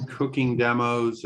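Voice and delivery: usual speaking voice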